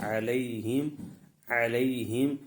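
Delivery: علیہم with a gentle, unstretched ay glide; علیہم, soft diphthong, neither dragged nor jerked; soft clear leen. Shown as a demonstration. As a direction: glide smoothly through the ay in علیہم, rising at the end. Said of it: "نرمی سے معروف پڑھیں گے".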